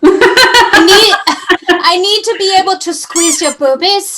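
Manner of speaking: put-on high, loud made-up foreign accent, almost French; hammed-up unplaceable European accent; vague foreign accent. Cohost jokes as the lecherous sculptor, in a hammy foreign accent. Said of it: "I don't know what accent that was".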